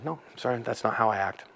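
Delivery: quiet firm refusal in a storm; calm low-volume reply. Shown as a demonstration. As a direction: go down when they go up. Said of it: "and I'd go down".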